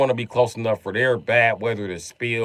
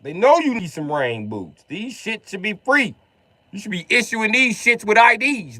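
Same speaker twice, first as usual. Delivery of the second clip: high-pitched comic outrage; indignant rant; 10 semitones higher than usual. Acted. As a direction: protest with the pitch pushed right up. Comic rant about free boots.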